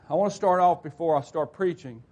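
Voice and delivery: ordinary delivery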